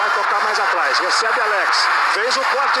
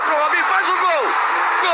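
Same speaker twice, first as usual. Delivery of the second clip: goal commentary shout; 6 semitones higher than usual. Acted. Live goal call.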